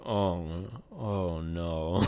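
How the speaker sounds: low, soft sinking reaction; understated "oh no" of dawning disappointment; mock dismay. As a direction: act out quiet dread, voice dropping. Retelling their dismayed reaction to twist.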